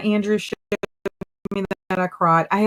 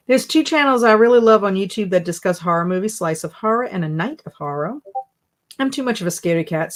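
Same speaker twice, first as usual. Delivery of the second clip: ordinary talk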